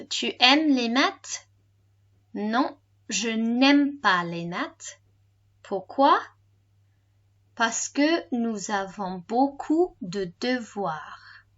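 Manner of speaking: slow French with pauses between phrases. Shown as a demonstration. Teacher repeats the homework answer for learners, slowly. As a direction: spell the dialogue out slowly for learners. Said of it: "And slower".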